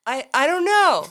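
high, loud giggly answer; barely holding in the laugh; mock kid laughing it off; laughing kid reply.

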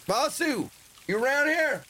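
high-pitched holler for someone; calling out. Acted. Traveler calling for Vasu to appear, calling out. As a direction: call out into the distance.

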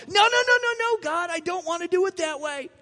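high, loud whiny refusal; hammed-up protest against God's way; mock protest. Cain refusing God, a hammed-up high whiny no. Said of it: "Cain was going".

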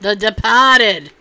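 put-on loud, drawn-out Boston accent. Host echoing a movie title for fun, exaggerated Boston accent. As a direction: relish it in a broad, slow Boston accent. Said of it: "Can't say that with a Boston accent enough".

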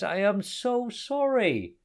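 high, slow wail; high-pitched, remorseful lament; wailing apology. A narrator's reading. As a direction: wail it out, stretching the words. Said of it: "He wailed loudly".

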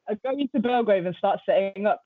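ordinary talk, nothing marked

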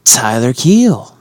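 ringmaster. Pretend. Slow grand introduction.

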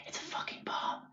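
hushed, voiceless crowd whisper; imitated whispering. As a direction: pass the news on in a whisper. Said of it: "whispering, they're like".

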